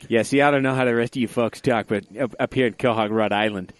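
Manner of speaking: put-on Rhode Island accent. Host parodies a cartoon Rhode Island dad, loud Rhode Island accent. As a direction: talk like a loud Quahog dad. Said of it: "what people from Boston sound like".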